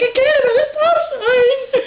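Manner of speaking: high, loud mock-frantic cry; panicked wail. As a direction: imitate a helpless driver shrieking back. Retold panicked driver answering.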